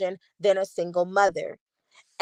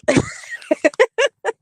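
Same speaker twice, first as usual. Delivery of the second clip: high laugh; 13 semitones higher, 4 dB louder than usual. Real, not acted. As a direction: burst of high-pitched laughter. Laughs at her own overreaction.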